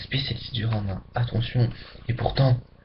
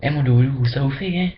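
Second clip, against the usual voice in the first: lightly put-on Martinican accent, a bit louder; 4 dB louder than usual. Back from Martinique, greeting someone in a light Martinican accent. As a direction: greet a friend with a hint of Martinican lilt. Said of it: "je parle comme ça".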